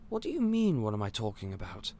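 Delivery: ordinary talk, nothing marked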